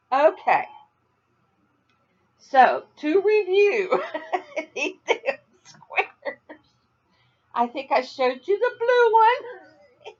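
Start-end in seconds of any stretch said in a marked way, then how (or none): 2.4-10.2 high, slow, laughing through words. Real, not acted. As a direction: try to talk through laughter. Still laughing while reviewing.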